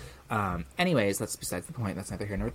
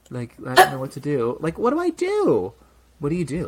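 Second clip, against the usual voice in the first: higher, louder helpless plea; exasperated cry; 5 semitones higher, 8 dB louder than usual. Real, not acted. Asking a friend what to do, high exasperated plea. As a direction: throw up your hands, pleading. Helpless exclaim about situation.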